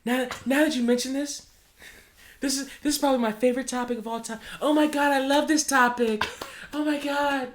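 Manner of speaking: high-pitched overexcited gushing; mock gushing fan. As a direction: ham up a giddy superfan. Over-eager fan persona.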